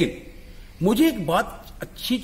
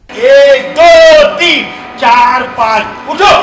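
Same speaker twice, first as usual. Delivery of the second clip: loud counting calls to the dancers; loud class count; 6 semitones higher, 10 dB louder, slower than usual. Real, not acted. Dance teacher counting the beats for his students, loud calls.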